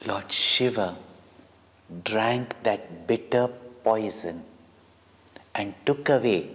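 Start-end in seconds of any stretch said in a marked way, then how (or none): none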